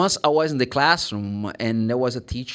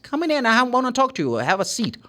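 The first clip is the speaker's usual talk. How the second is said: fast and higher, inviting in; quick welcome; 10 semitones higher, faster than usual. Acted. Host welcomes a visitor quickly and higher. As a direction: rush the welcome. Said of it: "You get the context".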